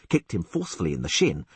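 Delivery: ordinary delivery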